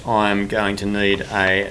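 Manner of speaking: ordinary delivery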